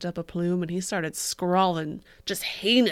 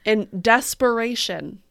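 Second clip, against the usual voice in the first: mock dramatic poetry reading; 8 dB louder than usual. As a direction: ham up the tragic verse.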